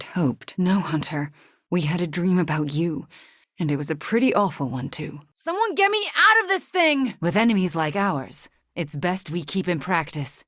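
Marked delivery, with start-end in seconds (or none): 5.3-7.1 high, desperate shout for help; panicked cry. Acted. Trapped mid-fight calling to allies, panicked high shout. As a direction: scream to be freed.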